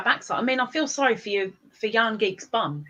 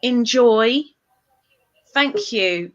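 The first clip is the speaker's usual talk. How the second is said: ordinary talk, nothing marked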